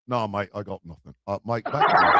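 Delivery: soft, low mock Cockney; Cockney accent. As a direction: mumble it in a light faux Cockney. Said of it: "it is Cockney accent".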